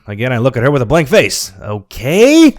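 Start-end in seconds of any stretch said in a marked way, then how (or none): 1.6-2.5 higher, rising uncertain okay; questioning okay. A narrator's reading. Narrator answers the rude customer, questioning. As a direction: answer with a puzzled lift. Hesitant questioning reply to staff.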